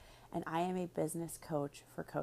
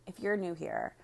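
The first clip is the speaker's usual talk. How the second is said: ordinary delivery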